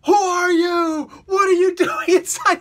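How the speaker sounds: high, loud, panicked yell; a boy freaking out and shouting at a stranger; freaked-out shout. Retold or imitated. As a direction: scream it like a terrified kid. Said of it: "Damian is completely freaked out".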